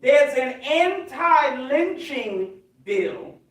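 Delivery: very slow, deliberate word by word; drawn-out, emphatic repetition. Real, not acted. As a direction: drive the repeated point home slowly. Repeats the point for emphasis.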